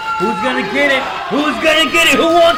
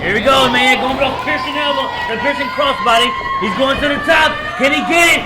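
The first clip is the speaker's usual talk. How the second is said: excited play-by-play; 5 semitones higher than usual. Real, not acted. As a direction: build the excitement as he climbs. High spot, commentator rising excitement.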